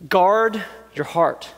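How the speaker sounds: slow, deliberate "guard your heart"; slow emphasis. Acted. Preacher driving home the lesson, slow and deliberate. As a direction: press the point slowly. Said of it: "preacher emphasis".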